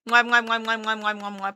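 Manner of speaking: blown kiss sounds, fading; between kisses. Real, not acted. Blowing kisses to chat.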